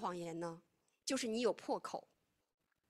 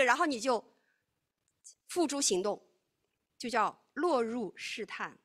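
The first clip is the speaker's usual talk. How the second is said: ordinary talk, nothing marked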